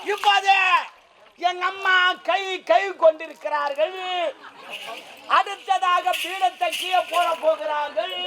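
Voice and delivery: ringing mock-formal announcement; mock live commentary. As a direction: announce each chore like a public event.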